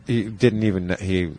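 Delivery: ordinary talk, nothing marked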